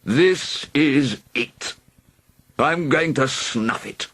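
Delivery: slow, lightly voiced fading dragon; dying dragon. A narrator's reading. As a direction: lament your end.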